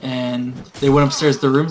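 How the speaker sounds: normal talking voice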